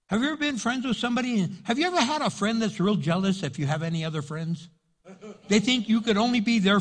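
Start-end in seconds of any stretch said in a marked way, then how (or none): none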